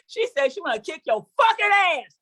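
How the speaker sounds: hammed-up angry threat to daughter; mock threat. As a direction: punch the threat. Punchline threat.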